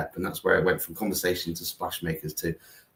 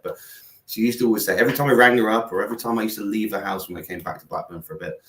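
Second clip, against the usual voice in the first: ordinary talk, nothing marked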